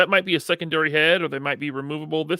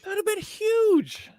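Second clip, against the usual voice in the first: very high, slow, drawn-out cry; excited exclamation; slower than usual. Real, not acted. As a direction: gush it high, stretching the last word. Gushing over imagined movie crossover.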